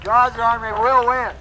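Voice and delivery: high, loud rallying cry; rally speech. Real, not acted. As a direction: rouse the crowd with it. Rallies the crowd again.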